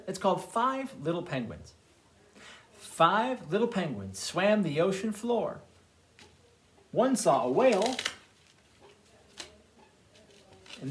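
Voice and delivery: put-on lively read-aloud for children; storytime reading. A narrator's reading. Librarian reads picture books aloud.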